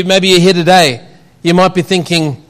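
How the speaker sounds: usual speaking voice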